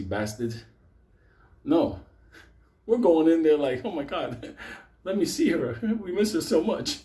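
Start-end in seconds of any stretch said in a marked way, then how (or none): 3.8-7.0 hammed-up, higher-pitched fake grief; mock mourning. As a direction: play a phony mourner, laughing through it. Pretend mourners entering the funeral.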